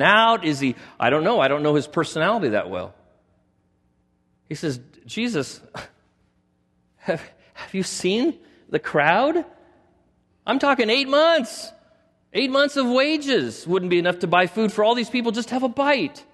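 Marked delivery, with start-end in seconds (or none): 5.1-16.2 higher, laughing disbelief at the crowd; a flustered disciple, half laughing; incredulous Philip. Acted. Philip acted out, laughing, panicked.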